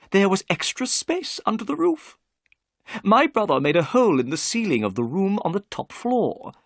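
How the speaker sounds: Thaddeus Sholto. A narrator's reading. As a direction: voice the excitable host. Sholto's voice in the story.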